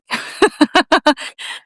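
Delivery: high giggle at oneself; amused laugh. Real, not acted. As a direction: laugh off your own fumbled pronunciation. Laughs at own mispronunciations.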